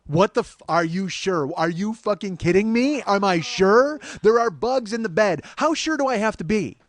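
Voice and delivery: loud, high indignant rant; incredulous outrage. Retold or imitated. Ranting at the manager over his question, outraged. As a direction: fume at the absurd question.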